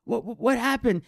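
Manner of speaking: put-on very high, insistent kid voice; amazed boy hollering questions; excited classmate. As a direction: call out eagerly, demanding answers. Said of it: "I hear Trevor say".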